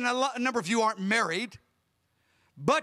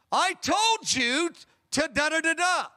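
slow, higher mock scolding of the kids; a hint of an exasperated mom; raised voice; 6 semitones higher than usual. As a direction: imitate a wife raising her voice at the boys. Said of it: "She raised her voice in that tone".

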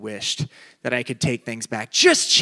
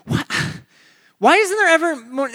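ordinary delivery

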